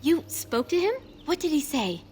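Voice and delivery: eager questioning. Acted. Elf asks outsider about her suitor, eagerly. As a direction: burst out with eager interest.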